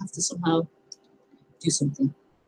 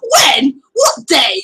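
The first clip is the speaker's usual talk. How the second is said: put-on high, loud child's voice, amazed; child's high shout; imitating a child; 5 semitones higher, 6 dB louder, much slower than usual. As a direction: mimic the boy's excited when what day. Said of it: "how he said it".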